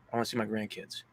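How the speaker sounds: very soft, hushed quote; imitated dying wish. Dying grandfather asking for his grandkids, very quietly imitated.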